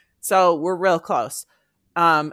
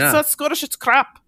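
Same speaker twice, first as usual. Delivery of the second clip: higher-pitched put-on Scottish accent; 6 semitones higher than usual. Shown as a demonstration. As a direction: do the tagline in playful Scottish. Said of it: "In a Scottish accent".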